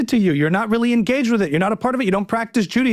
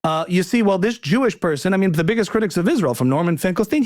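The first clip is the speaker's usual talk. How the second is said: ordinary talk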